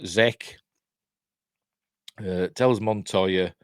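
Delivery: usual speaking voice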